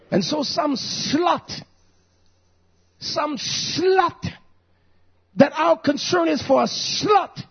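high, forceful preacher's shout; fired-up preaching. Real, not acted. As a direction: shout it from the pulpit, drawing out key words.

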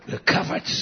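whispered, breathy reassurance; a whispered promise to the congregation; hushed declaration. Real, not acted. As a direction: drop to a whisper after the shout. Hushed after shouting.

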